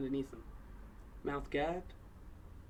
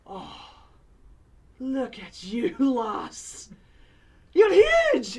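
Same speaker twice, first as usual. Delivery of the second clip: high, loud, laughing greeting; light up at seeing an old friend; delighted old dwarf; 7 semitones higher, 14 dB louder, much slower than usual. Acted. Old dwarf woman greeting a long-lost friend, overjoyed. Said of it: "her face goes from stern yelling at people to immediate".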